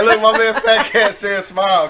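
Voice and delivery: laughing. Real, not acted. Laughing teasing cohost.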